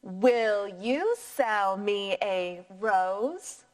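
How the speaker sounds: slow, rising question tune. Shown as a demonstration. Teacher modelling Benny's question for the class, slow rise. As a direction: lift the voice at the end. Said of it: "Try that one more time with me".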